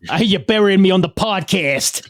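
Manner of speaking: gruff brother-talk wrestler; Taz impression. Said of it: "or however he talks".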